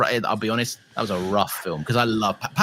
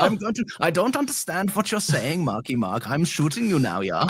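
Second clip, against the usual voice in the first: put-on German accent; 4 semitones higher than usual. Soldier about to shoot [name], mock German accent. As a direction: play it as a hammy German soldier. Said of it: "Like, a stereotypical one".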